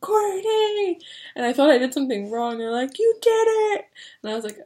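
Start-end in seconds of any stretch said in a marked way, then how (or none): 0.0-1.0 high cheering shout of the name; screaming a name. Retold or imitated. Radiology staff celebrating patient, high excited shout. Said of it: "they were screaming my name". 3.0-3.9 high, loud celebratory shout; cheering. Retold or imitated. Radiology staff celebrating the patient, high loud cheer.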